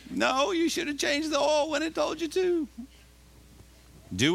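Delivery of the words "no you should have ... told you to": very high-pitched, hammed-up rebuke; mock scolding. The Lord answering a complaining driver, mock scolding. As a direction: scold comically in a squeaky put-on voice. Acted scolding reply from God.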